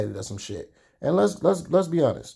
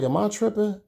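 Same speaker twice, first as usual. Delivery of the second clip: much higher, disbelieving question; incredulous; 10 semitones higher than usual. Retold or imitated. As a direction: ask yourself in disbelief. Doubting himself after cold greeting.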